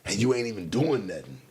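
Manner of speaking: ordinary delivery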